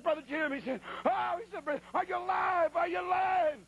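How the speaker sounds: a missionary shouting to a downed rider; alarmed high cry of are you alive; frantic shout. Retold or imitated. Missionary shouting over injured man.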